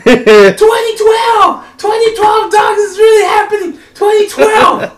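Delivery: high-pitched frantic yelling; imitated screaming. Friend panicking in the car during the hailstorm, shrieking. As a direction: shriek it like the world is ending. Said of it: "you have Miguel screaming".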